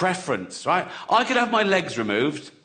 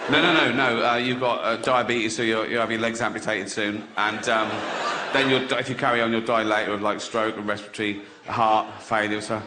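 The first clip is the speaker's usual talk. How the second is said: lightly acted doctor, low-pitched delivery; doctor breaking bad news; 7 semitones lower than usual. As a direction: play a doctor listing grim news in a lower voice.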